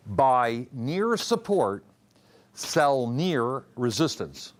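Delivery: near stretched and leaned on, slow; slow teaching emphasis on near; stress on near. Shown as a demonstration. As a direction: lean on the word near, slowly. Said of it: "I emphasized the word near".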